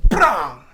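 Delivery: loud shouted bang; shouted imitation of a slammed door. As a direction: mimic the door slamming hard. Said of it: "and he go".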